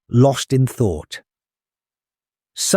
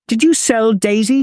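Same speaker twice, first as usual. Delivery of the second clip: higher, eager hopeful question; hopeful mother; 9 semitones higher than usual. Acted. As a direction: ask full of hope. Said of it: "she asked hopefully".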